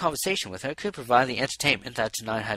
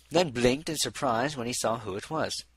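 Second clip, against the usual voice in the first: ordinary delivery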